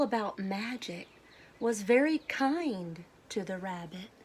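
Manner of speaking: clear, lively storybook read-aloud; children's story narration with gentle emphasis. Acted. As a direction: read it like a picture book to a class. Teacher reads picture book aloud.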